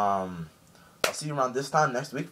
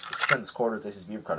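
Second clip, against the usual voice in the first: ordinary talk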